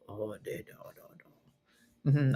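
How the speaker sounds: very soft, low creepy man; barely audible deep mumble; Duolingo man voice. Retold or imitated. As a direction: imitate the lesson man answering, deep and hushed. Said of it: "He's gonna be like".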